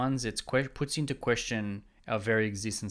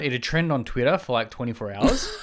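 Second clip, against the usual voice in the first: usual speaking voice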